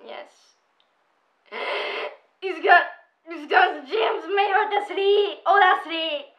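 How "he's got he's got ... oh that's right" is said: high, loud fan outburst; excited gushing. Pretend. Gushing over a favourite K-pop singer, excited shouting.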